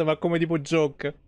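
ordinary delivery

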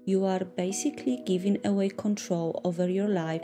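usual speaking voice

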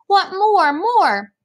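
high, loud mock-crying little brother; crying toddler demand. A narrator's reading. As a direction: wail it as a small child begging for food. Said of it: "Fudge started to cry".

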